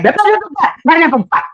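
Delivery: high-pitched imitation of nonstop arguing; mock ranting babble. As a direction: babble on like a caricature of a ranting partner. Mimicking a partner mid-rant.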